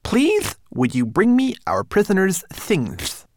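high little manservant voice; fussy tiny homunculus. Acted. Twigleg politely asks.

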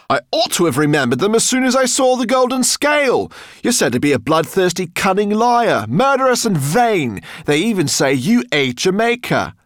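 high-pitched shouted tirade; loud cry. A narrator's reading. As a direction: hurl accusations at the monster, loud and high. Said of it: "he cried".

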